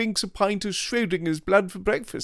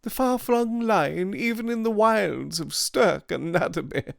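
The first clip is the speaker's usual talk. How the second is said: mocking Devine; 12 semitones higher than usual. A narrator's reading. Devine mocks school sentiment.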